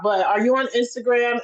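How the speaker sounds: ordinary talk, nothing marked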